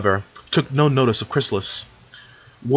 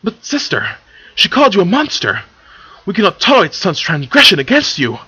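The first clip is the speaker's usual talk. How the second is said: loud, high-pitched outburst of outrage; indignant protest; 7 semitones higher, 5 dB louder than usual. Acted. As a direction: protest the insult to your sister, voice raised. Luna protests an insult to sister.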